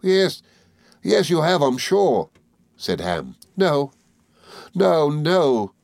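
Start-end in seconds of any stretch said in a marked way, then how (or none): 0.0-2.3 high, insistent character voice; lightly voiced earnest reassurance; reassuring Ham. A narrator's reading. Ham reassures weeping Emily. 3.6-5.7 high, distressed refusal; understated tearful denial; sobbing. A narrator's reading. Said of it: "sobbing and shaking her head".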